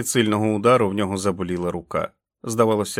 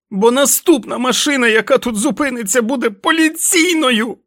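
furious shouting; 8 dB louder than usual. Acted. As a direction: scream at him with each blow. Jack beating and yelling at wolf. (несамовитий лютий крик)

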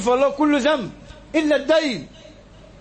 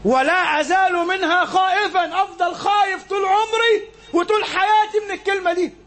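impassioned preaching; 10 semitones higher than usual. Acted. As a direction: plead it out high and drawn. Preacher repeats the quote with passion.

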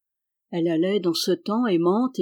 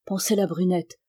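usual speaking voice

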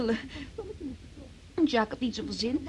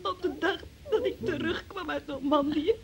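crying; 4 semitones higher than usual. Acted. Tearful answer about the day.

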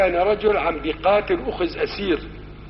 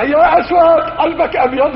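crying out; 7 semitones higher, 5 dB louder than usual. Retold or imitated. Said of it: "يصيح".

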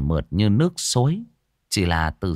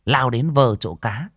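ordinary delivery

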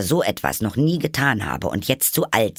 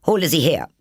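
high, loud commanding queen; evil queen; 7 semitones higher, 4 dB louder, faster than usual. Acted. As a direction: snap it like a furious queen. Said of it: "sagte die Königin zum Henker". (böse Königin, herrisch)

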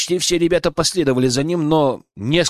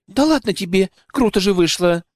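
higher, bright unrepentant exclaim; cheerful exclaim; 7 semitones higher than usual. A narrator's reading. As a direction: toss it off gleefully, no regret. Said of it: "Без единого намёка на раскаяние воскликнула".

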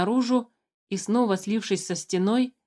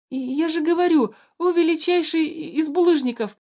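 panicked; 9 semitones higher, 4 dB louder than usual. A narrator's reading. Terrified guard blundering.

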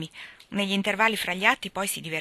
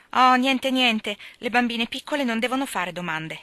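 a sharp, dismissive "oh, nothing"; curt brush-off; 5 semitones higher, 6 dB louder than usual. Acted. Jo curtly brushing off her nosy little sister. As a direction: cut her off, louder and higher. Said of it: "secca secca".

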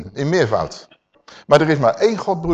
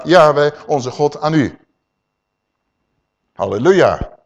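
normal talking voice

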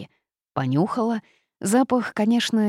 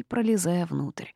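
ordinary delivery

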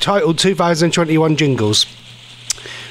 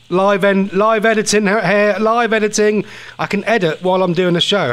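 ordinary delivery

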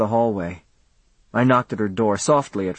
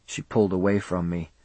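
usual speaking voice

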